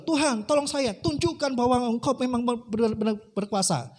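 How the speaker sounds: high acted appeal to God; pleading prayer. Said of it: "dia berdoa". (doa memohon dengan nada tinggi)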